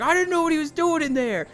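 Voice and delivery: babbling excuses in a panic; flustered guard. Retold or imitated. Arrested guard defending himself, high fast flustered voice. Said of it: "He's like".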